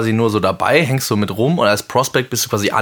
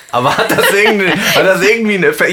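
laughing; 9 semitones higher than usual. Real, not acted.

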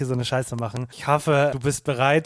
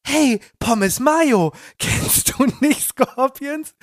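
Heidi impression; 9 semitones higher, much slower than usual. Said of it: "meinte Heidi so".

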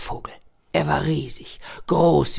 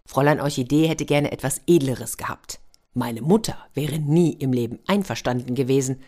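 plain reading voice

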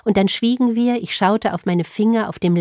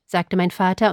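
plain reading voice

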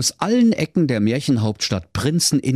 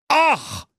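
expectant king. Acted. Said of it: "sprach da der König erwartungsfroh".

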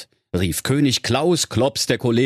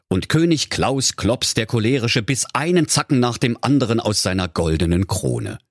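ordinary delivery

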